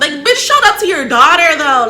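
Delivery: loud high outraged cry; outraged loud exclaim. Real, not acted. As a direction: shout it in disbelief. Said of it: "excited outrage".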